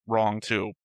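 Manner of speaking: normal talking voice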